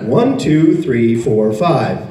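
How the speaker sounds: plain, measured American count; American accent. Retold or imitated. Imitating a dialect tape, American accent. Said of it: "This is an American accent".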